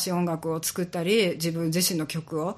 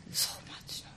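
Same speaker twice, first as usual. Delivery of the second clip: whisper; 15 dB quieter than usual. Real, not acted. Whispered aside in interview.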